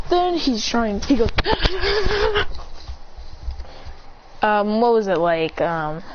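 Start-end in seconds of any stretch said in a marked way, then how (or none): 1.4-4.1 long, high stuttered fight cry; mock straining cry. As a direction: strain out broken high cries, held back.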